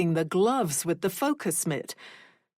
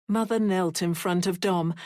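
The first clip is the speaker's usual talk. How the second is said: usual speaking voice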